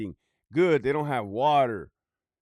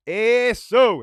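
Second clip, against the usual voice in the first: loud, high-pitched shout; yelled cheer; 7 semitones higher, 9 dB louder than usual. Real, not acted. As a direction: yell it out suddenly, high and loud. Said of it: "And stop yelling".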